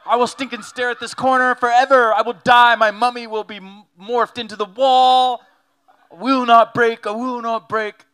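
loud, high, hammed-up stubborn kid; defiant child. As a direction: shout like a strong-willed child refusing to give in. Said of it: "I was a strong-willed child".

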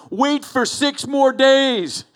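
high, loud, slow mock taunt; sing-song jeer back at the enemies on the walls; taunting reply. Israelite marching round Jericho jeering back at taunting guards, high mocking call. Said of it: "And they're taunting them".

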